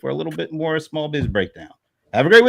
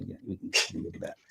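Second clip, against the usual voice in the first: quick whispered aside; muttered aside; 6 semitones lower, 16 dB quieter, faster than usual. Real, not acted. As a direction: rush it under your breath. Muttered aside after a critique.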